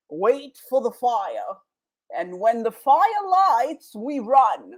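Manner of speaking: put-on slow, drawn-out English accent; mock English accent. Acting out people long ago awaiting the fire, mock English accent. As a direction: put on a playful English accent, taking your time. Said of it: "I like doing the English accent".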